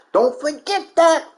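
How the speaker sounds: normal talking voice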